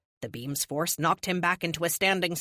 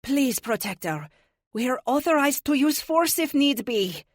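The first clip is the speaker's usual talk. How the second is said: put-on higher French-accented voice, begging; French heroine pleading with him; 8 semitones higher, 4 dB louder than usual. A narrator's reading. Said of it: "Magnifique said pleadingly".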